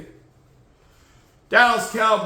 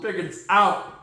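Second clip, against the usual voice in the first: ordinary talk, nothing marked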